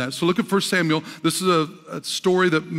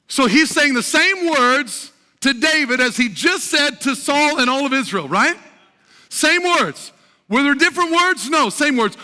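impassioned preaching; 9 semitones higher, 5 dB louder than usual. Real, not acted. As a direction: preach it out emphatically to the congregation. Preaches at full force.

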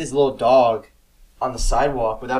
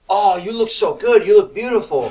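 gushing street seller; 8 semitones higher, 7 dB louder than usual. Retold or imitated. Street seller flattering a tourist in a shirt, mock gushing. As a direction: lay on hammed-up salesman praise.

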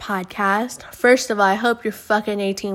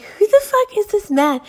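jumps way higher in disbelief; incredulous outburst; 11 semitones higher than usual. Retold or imitated. Friends baffled by the strange caller, incredulous high outburst.

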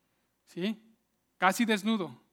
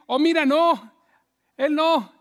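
higher, louder, emphatic refusal; envious objection; 6 semitones higher, 7 dB louder than usual. Acted. Jealous people run down the promoted man, loud objecting. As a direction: protest loudly against him. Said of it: "le empiezan a decir".

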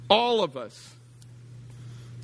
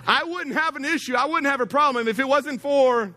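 higher, whiny blame-shifting voice; mock self-pity; 9 semitones higher than usual. Pastor imitates blame-shifting listeners, higher and whiny. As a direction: whine like you're the victim. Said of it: "this is what we're saying".

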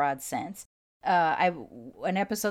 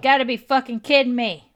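exasperated outburst; 7 semitones higher, 10 dB louder than usual. Real, not acted. Recording ruined by drill noise.

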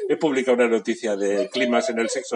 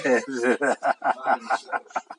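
laughing. Real, not acted. Laughs after the wolf threat.

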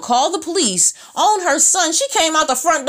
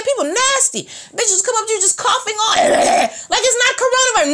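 exasperated shout; 10 semitones higher than usual. Real, not acted. Ranting about coughing customers.

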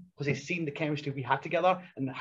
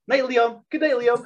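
teasing goodnight; 9 semitones higher, 6 dB louder than usual. Retold or imitated. Teasing goodnight while zipping tent.

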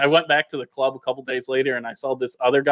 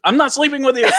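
high, emphatic comic refusal; mock protest; 10 semitones higher, 4 dB louder than usual. Teller warns anyone buying shots, mock protest. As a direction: blurt it out as a joking warning.

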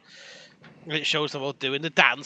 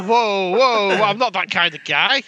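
mock prudish protest; 6 semitones higher, 6 dB louder than usual. Joking refusal of advances.

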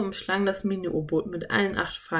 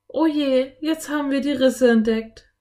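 higher, louder worried exclamation; dismayed; 8 semitones higher, 6 dB louder than usual. A narrator's reading. Anne discovering cracks in the submarine, dismayed. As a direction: sound the alarm in dismay.

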